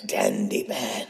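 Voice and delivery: low, slow, soft menace; hushed, slow monster reveal; villain voice. Acted. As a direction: draw the name out as a spooky villain. Introduces himself as villain.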